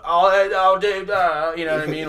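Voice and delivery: high, loud mock London slur; loud hammed-up northern London drunk accent. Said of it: "you know those people like".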